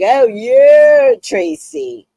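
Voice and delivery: high, loud sweet tease of the name; sweet teasing. Retold or imitated. Coworker teasing her in a sweet way, high and loud. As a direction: say it sweetly with a wink. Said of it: "She was like".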